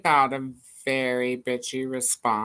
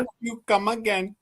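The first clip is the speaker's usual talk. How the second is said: lightly put-on cartoon Indian accent, higher; 5 semitones higher than usual. Imitating the Simpsons shop guy, higher Indian accent. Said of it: "She sounded like, what's the dude from".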